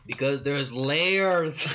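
loud, drawn-out ogre voice; Shrek impression. Friend joking through a film impression, slow loud ogre. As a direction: stretch it out slowly like a Scottish cartoon ogre. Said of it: "Can you do a Shrek impression?".

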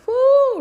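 a high, loud whoop of amazement; excited whoo. Real, not acted. Preacher whoops at God's sharp reply, high and loud. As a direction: let out a big high whoo at the verse.

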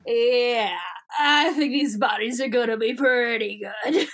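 drawn-out, hammy reply; put-on alien character voice. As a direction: drag the words out in character. Acts alien answering in character.

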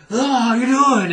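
high, loud spooky hello; mock haunted butler greeting; creepy greeting. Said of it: "I'd be like".

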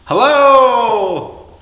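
loud high hello; calling out. Pretend. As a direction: call out hello to hear the echo. Echo call again.

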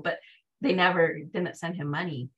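normal talking voice

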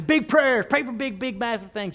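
high, loud overexcited pray-er; mock wild prayer. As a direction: ham up a greedy big prayer. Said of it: "don't be getting wild out there saying".

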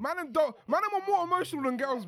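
loud and high, protesting. Real, not acted. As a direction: shout it out playfully. Heated banter, loud claim.

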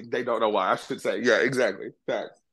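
ordinary talk

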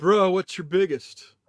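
slow laid-back surfer dude drawl; California surfer accent. Pretend. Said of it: "I can even speak California".

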